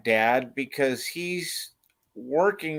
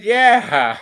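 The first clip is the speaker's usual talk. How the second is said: loud yeah; 9 semitones higher, 10 dB louder than usual. Retold or imitated. Answering the confused crowd.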